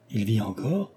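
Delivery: soft, stunned question rising on encore; astonished question. A narrator's reading. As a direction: breathe it in disbelief. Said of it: "s'écria Cécile".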